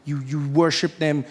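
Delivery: ordinary talk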